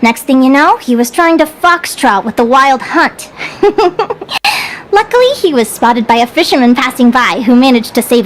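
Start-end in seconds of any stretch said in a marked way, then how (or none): 3.4-4.9 light, high little giggle; amused chuckle. Acted. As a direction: chuckle at your own anecdote. Amused by own mushroom anecdote.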